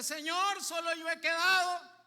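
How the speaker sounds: loud, high mock lament; whining complaint. Elijah complains to the Lord, loud mock lament. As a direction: cry out like a self-pitying prophet. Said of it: "Elías está lloriqueando".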